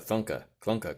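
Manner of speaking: plain reading voice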